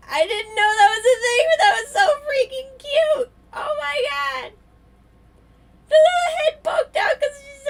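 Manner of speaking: squealing with delight. Real, not acted. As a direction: squeal like you just saw the cutest thing ever. Baby koala appears in game.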